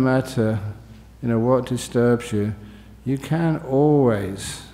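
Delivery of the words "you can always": slow, measured and deliberate; careful emphasis. Real, not acted. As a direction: draw out each word with care. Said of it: "I say this very".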